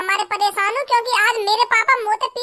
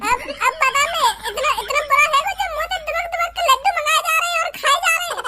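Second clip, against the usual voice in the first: laughing rant; 6 semitones higher than usual. Acted. Boy ranting about father's laddoos.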